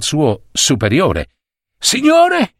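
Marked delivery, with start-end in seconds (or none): 1.8-2.5 high, gasping cry of sir; panting, voice jumping up high; out of breath. A narrator's reading. Errand boy gasping to his boss, out of breath. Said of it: "disse annaspando per via del fiato corto".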